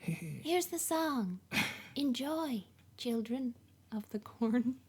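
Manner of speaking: put-on slow, gentle old lady; a sweet grandma voice, slowly; soft old lady voice. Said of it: "That's my soft old lady voice".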